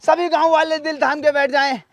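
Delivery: loud public announcement; showman's announcement. Acted. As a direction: build up the big reveal loudly. Announces great-grandfather's unveiling to crowd.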